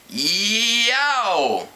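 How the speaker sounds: sharp high yelp; pained yelp. A narrator's reading. Editor Jake yelps at pencils pointed up, pained yelp. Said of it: "he cried".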